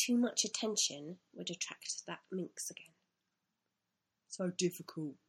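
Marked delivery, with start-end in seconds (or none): 4.3-5.2 lightly voiced creature thinking to itself; a hint of a put-on character voice; understated inner grumble; small animal's thoughts. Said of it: "he thought".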